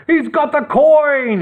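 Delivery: high, slow alarmed yell. A narrator's reading. Robber chief sees Nick escape, yelling high. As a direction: alert the others. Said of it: "yelled the chief".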